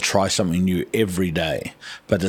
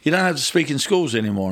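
quick, higher official voice; probation officer; 7 semitones higher, 4 dB louder, faster than usual. Retold or imitated. Probation officer phoning to end his school talks, brisk. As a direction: rattle it off like a brisk officer on the phone. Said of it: "rang me up and said".

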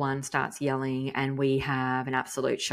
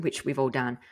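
usual speaking voice

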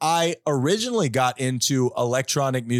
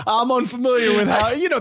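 put-on Southern accent; 5 semitones higher than usual. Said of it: "It's not a terrible Southern accent".

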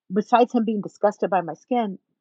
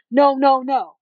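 louder, higher rapid-fire no's; emphatic refusal; 5 semitones higher, 6 dB louder, slower than usual. Retold or imitated. Man talking himself out of his attraction, mock emphatic refusal. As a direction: blurt out a refusal, imitating him.

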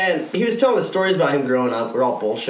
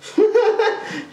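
short high-pitched laugh; real laugh, high. Real, not acted. Laughs at own bit.

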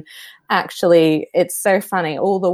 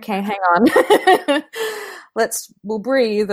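laughing mock calm; 4 semitones higher, slower than usual. Voicing calming self, laughing.

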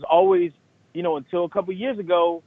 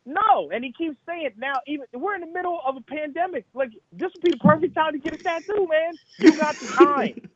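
indignant teasing; 12 semitones higher than usual. Real, not acted. Teasing absent friend about tattoo.

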